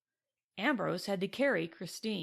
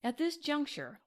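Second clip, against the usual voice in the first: plain reading voice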